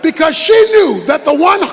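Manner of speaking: forceful preaching. Acted. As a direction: preach it hard to the congregation.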